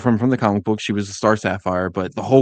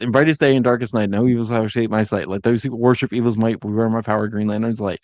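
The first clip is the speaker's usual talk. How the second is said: rushed oath; faster than usual. Shown as a demonstration. Host mimicking Hal reciting the oath, really fast. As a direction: rattle it off just to get through it. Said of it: "say it that fast".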